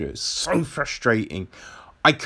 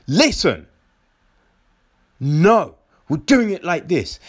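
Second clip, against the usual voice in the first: louder, firm take-charge voice; firm command; 4 semitones higher, 3 dB louder than usual. Retold or imitated. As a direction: lay down the plan. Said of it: "the person in the right is just like".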